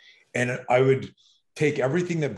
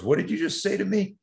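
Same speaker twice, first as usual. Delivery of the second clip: mock bully challenge; 9 semitones higher than usual. Bully confronts Mitchell.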